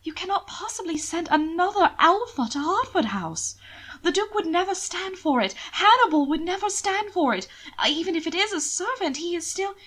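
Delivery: a hint of an appalled brother; appalled protest. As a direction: push back in disbelief.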